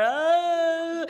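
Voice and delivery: high, loud anguished wail; mock despairing wail. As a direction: let out a loud cry of despair. Shock at the scale.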